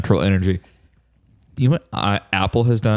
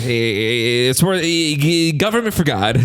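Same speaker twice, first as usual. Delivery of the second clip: higher-pitched pundit impression, stammering; Ben Shapiro; 5 semitones higher than usual. Co-host citing commandments against natural energy, higher-pitched stammering pundit impression. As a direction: stumble through it in a raised, insistent voice. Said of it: "it was pretty Ben Shapiro impression".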